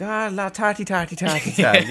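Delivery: high, mocking stage-Irish film accent. Mocking the film's fake Irish accent to his co-host. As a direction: sends up a Hollywood Irish accent. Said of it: "doing the Irish accent".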